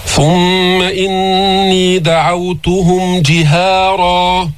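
slow, drawn-out tajweed recitation; Quran recitation. Shown as a demonstration. Teacher recites the verse for his students, slow melodic tajweed. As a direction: chant each verse slowly and melodiously.

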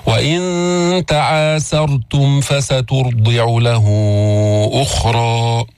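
slow chanted tajweed recitation; measured melodic recitation; Quran recitation. Shown as a demonstration. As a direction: recite it slowly with full tajweed.

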